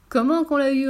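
high gleeful reply; laughing guitar. A narrator's reading. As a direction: crow it with laughter rising. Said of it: "un grand éclat de rire".

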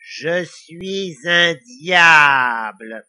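very slow, loud devilish announcement; grandiose devil. A narrator's reading. Devil introducing himself to Lily, grandiose. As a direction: draw it out with devilish pride.

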